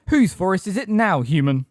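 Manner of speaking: loud, high taunt; triumphant bellow. A narrator's reading. Bane taunting Umbridge after the arrow, loud bellow. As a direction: bellow it at a beaten foe. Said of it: "bellowed Bane".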